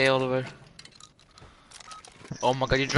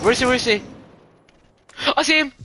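rising alarmed yelp, higher; frantic search, then alarmed; panicking gamer; 8 semitones higher, much faster than usual. Real, not acted. Spotting enemy mid-fight.

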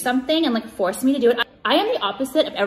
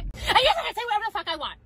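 much higher, fast, sassy comeback; mocking retort; 9 semitones higher than usual. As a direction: snap it back in a taunting sing-song.

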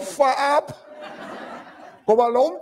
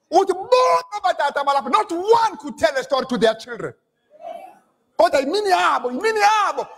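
fiery preaching; 7 semitones higher than usual. Acted. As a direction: rouse the congregation, full force. Preaching at full force.

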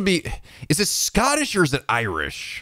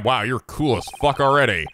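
normal talking voice

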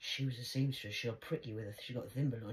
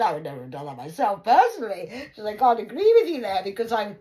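disapproving gran; 3 semitones higher, 15 dB louder than usual. Retold or imitated. Grandma disapproving.

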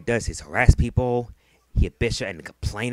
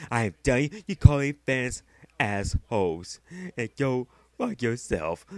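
mocking quote. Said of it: "you be like".

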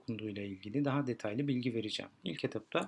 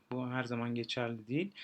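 usual speaking voice

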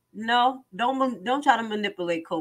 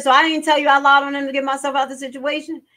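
higher, louder, fast disbelieving retort; incredulous witness; 5 semitones higher, 5 dB louder, faster than usual. Retold or imitated. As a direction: fire it back in disbelief. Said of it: "He said".

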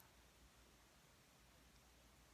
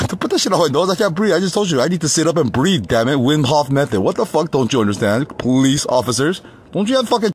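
angry protest; 4 semitones higher, 9 dB louder than usual. Retold or imitated. Retold protest against mask.

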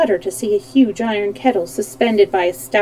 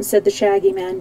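ordinary delivery, nothing marked

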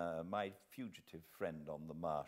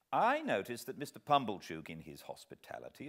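ordinary delivery

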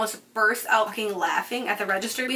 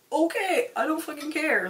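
higher, softer, brush-off okay; couldn't-care-less brush-off, pitched up; dismissive retort; 5 semitones higher, 4 dB quieter than usual. Retold or imitated. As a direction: shrug it off in a higher, lighter voice. Retort to customer storming out.

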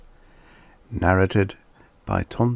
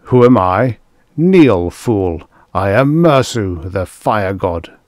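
loud, imperious proclamation; indignant fire god; 7 dB louder than usual. A narrator's reading. Mersu to the priest who questions him, indignant and imperious. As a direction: command them as an outraged god.

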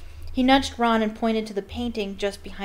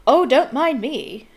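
loud, slow, higher-pitched huff; irritable calling; 4 semitones higher, 7 dB louder, slower than usual. A narrator's reading. Fat Lady calling after the departing students, irritable. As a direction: call out drawn-out and put-upon. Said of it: "called irritably after them".

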